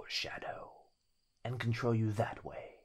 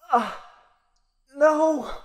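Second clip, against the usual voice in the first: higher, much louder cry of no; horrified boyfriend; 14 dB louder than usual. Acted. Boyfriend seeing the girl give in, anguished cry. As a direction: break as all is lost.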